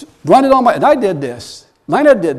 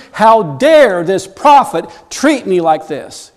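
acting an offended general, louder and higher; indignant anger; 4 semitones higher, 4 dB louder than usual. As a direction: rage like a snubbed man of rank. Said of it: "he is angry".

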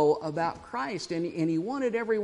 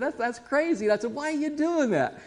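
much higher, amused disbelief; laughing incredulity; 10 semitones higher than usual. Retold or imitated. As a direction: sound amazed and amused at a friend. Incredulous retold reaction.

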